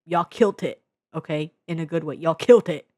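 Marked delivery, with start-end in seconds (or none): 2.2-2.9 loud praise. Real, not acted. Praising the filmmakers.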